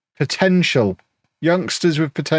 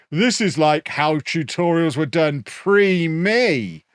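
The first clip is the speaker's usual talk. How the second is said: slow, higher, smug put-on voice; Zealand wang voice; 5 semitones higher, slower than usual. Mocking a rival YouTuber's quote, slow smug put-on voice. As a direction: drag it out like a self-satisfied know-it-all. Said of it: "I'm just gonna do a wang voice".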